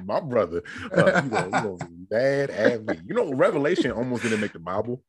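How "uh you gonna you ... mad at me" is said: laughing through the words. Real, not acted. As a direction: chuckle while talking. Laughing while teasing his co-host.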